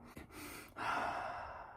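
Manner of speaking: heavy breathy sigh out; demo exhale sigh. Shown as a demonstration. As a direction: show the too-deep exhale. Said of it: "So instead of breathing, like".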